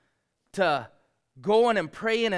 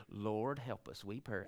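softer, lower, mild recital; tame reading; 7 semitones lower, 12 dB quieter than usual. Shown as a demonstration. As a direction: read it meek and calm, as printed. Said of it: "I don't believe this is like we read it".

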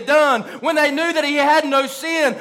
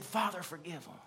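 very soft, low, near breathless; tender hushed plea; 8 semitones lower, 21 dB quieter than usual. Retold or imitated. Jesus asking the Father to forgive, hushed and tender. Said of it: "so much compassion that he said".

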